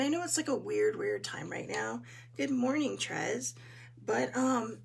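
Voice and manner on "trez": ordinary talk, nothing marked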